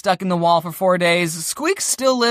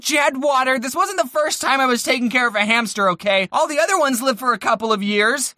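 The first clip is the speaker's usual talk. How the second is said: normal talking voice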